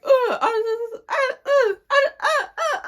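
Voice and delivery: high, loud mock stammering; squeaky, broken imitation of floundering. Imitating the cousin in the argument, mocking stammer. Mocks cousin lost for words.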